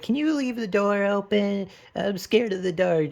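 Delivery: put-on high, timid little girl voice; scared little girl. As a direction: plead like a frightened child. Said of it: "she's like".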